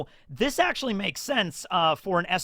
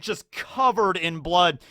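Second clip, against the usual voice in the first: ordinary delivery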